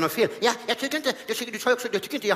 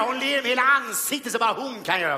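usual speaking voice

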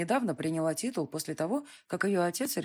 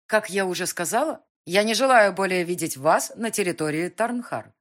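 cold anger; 3 semitones higher, 8 dB louder than usual. Acted. Heroine orders count out.

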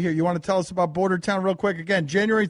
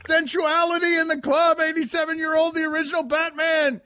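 excited hype; 10 semitones higher than usual. Real, not acted. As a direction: sell it like a party MC. Hyping Adam West clip.

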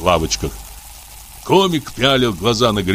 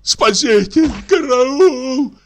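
high, loud scream for help; terrified cry; 3 dB louder, much slower than usual. A narrator's reading. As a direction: yell for help in panic. Комик в ужасе зовёт. (в ужасе, громко)

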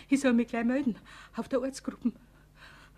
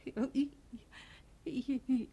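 frightened whisper; 5 dB quieter than usual. Acted. Frau Wenzel whispers her guilty secret to Herr Fuchs. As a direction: breathe the confession out, barely voiced.

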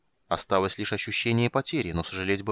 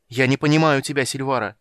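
loud, higher anguished shout; 4 semitones higher, 7 dB louder than usual. Acted. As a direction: cry it out at her in despair. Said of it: "крикнул Гилтонос". (громкий отчаянный крик)

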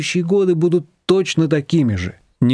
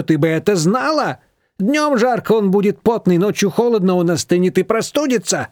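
higher, reproachful lecturing voice; scolding mother-in-law; 7 semitones higher than usual. A narrator's reading. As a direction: lecture her for not caring about your son. Manana scolds Nina.

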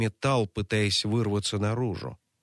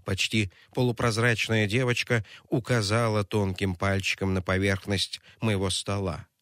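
ordinary delivery

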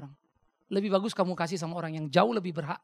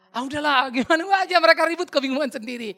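loud, much higher dismissive wife; exasperated wife; 9 semitones higher, 11 dB louder than usual. Retold or imitated. Wife telling her fretting husband to drop it, loud and dismissive. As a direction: brush it off as the fed-up wife. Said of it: "ngomong istrinya".

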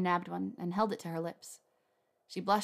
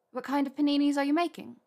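put-on higher, brighter young woman; Hermione voice; 7 semitones higher, 6 dB louder than usual. A narrator's reading. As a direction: lift the voice for Hermione.

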